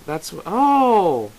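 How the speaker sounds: long, high, loud "oh"; slow, swooping reaction; dawning realization. Acted. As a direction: stretch it out as it sinks in. Suddenly realises what was meant.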